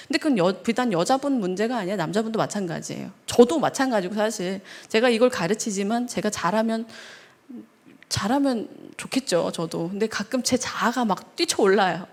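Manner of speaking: usual speaking voice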